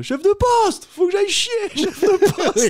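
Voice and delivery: high, loud pleading call through a door. Retold or imitated. Detainee shouting through cell door. (appel crié et aigu à travers la porte)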